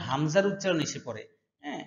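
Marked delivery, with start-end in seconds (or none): none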